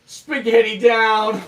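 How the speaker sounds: high, loud, slow alarmed yell; shout. Real, not acted. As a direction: cry out as the food hits the ground. Drops the spaghetti on camera.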